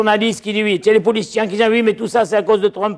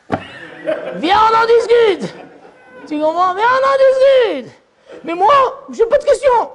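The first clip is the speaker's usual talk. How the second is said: high, sing-song inviting call; high playful invitation; playful divine call; 14 semitones higher, slower than usual. Acted. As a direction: coax like calling someone home. Hachem calls, man replies.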